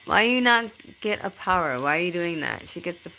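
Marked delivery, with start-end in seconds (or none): none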